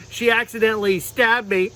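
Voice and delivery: ordinary talk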